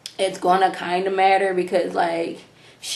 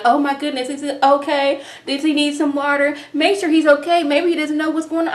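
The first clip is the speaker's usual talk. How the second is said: high, hammed-up fretting mother; mock fretting Jackie; 6 semitones higher than usual. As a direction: overplay a panicking mom at a haircut. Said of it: "about the doggone pass out".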